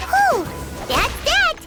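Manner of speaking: loud, high-pitched relief; relieved. Acted. Companion after clearing out the monsters, relieved. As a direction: let out a big whew of relief.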